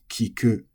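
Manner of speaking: kiku level, no pitch drop; flat accent. Shown as a demonstration. Teacher demonstrating a flat verb, kiku without accent. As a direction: say kiku unaccented, staying up on ku.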